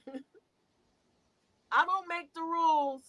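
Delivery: ordinary talk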